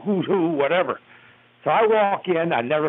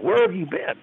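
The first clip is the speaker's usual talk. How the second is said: mild mock yell, higher-pitched; toned-down imitation of yelling; retold yell; 6 semitones higher than usual. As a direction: echo the boss's demand at half force. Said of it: "he yelled real loud".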